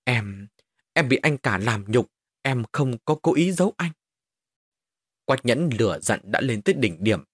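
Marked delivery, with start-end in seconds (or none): none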